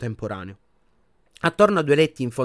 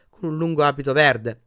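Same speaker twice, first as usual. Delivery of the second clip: plain reading voice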